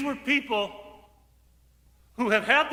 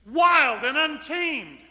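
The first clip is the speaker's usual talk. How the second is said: fervent emphasis; 7 semitones higher, 4 dB louder, slower than usual. Real, not acted. Fervent preaching about fire.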